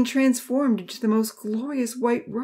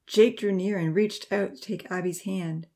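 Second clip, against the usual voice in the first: ordinary delivery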